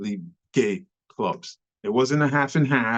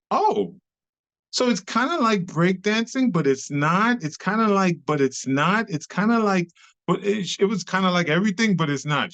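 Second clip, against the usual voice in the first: higher, lightly voiced puzzled woman; mock her fumbling search for words, pitched up; puzzled lady; 6 semitones higher than usual.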